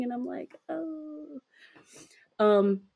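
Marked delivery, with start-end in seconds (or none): 0.7-1.4 fake stretching. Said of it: "I pretend to be stretching".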